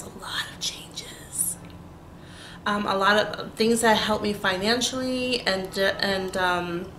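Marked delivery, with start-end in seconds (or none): 0.0-1.6 whispering. Real, not acted. Whispers teasing to viewers.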